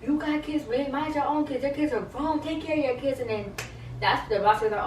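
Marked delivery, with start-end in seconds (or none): 0.0-3.2 higher, mock heated telling-off; a hint of a furious castmate; imitating a cursing rant. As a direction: retell her going off, half-acted. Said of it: "cursing up a storm".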